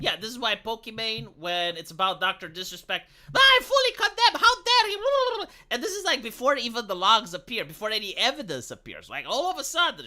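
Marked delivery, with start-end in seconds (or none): 3.3-5.4 high, loud, hammed-up indignation; shrill-pitched parody of righteous condemnation; mock outrage. Mocking an influencer's instant condemnation, hammed-up outrage.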